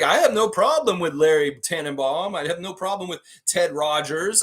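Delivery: high, amused imitation; amused mock quote. Host retelling the name drop, amused mock quote. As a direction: quote it high, on the edge of laughter.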